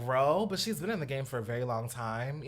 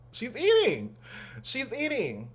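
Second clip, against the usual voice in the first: excited; 8 semitones higher, slower than usual. Real, not acted. Hyping the photo shoot.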